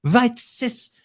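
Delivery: shrill mock child's tantrum; bossy little princess. Mocking Greta's outrage at George.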